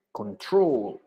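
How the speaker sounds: CONTROL with TROL drawn out and stressed; second-syllable stress. Shown as a demonstration. Teacher repeats the example, CONTROL stressed on TROL. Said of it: "el acento en la segunda sílaba".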